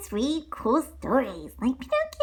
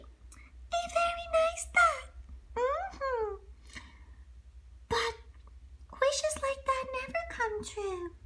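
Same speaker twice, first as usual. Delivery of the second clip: high cricket voice; high cricket asking the kids; Jiminy Cricket; 12 semitones higher, 4 dB quieter than usual. Acted. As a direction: wonder aloud as the tiny cricket. Cricket narrator comments.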